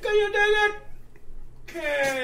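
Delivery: high, loud preacher-like movie quote; rally the crowd like Cyrus. Retold or imitated. Quoting Cyrus from The Warriors.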